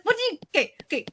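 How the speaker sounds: ordinary talk, nothing marked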